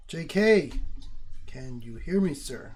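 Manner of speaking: loud, high call to a friend who has dozed off. Real, not acted. Calling out to a sleeping teammate, loud and high. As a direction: raise your voice to get his attention.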